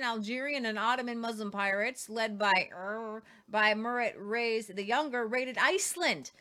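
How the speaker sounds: ordinary talk, nothing marked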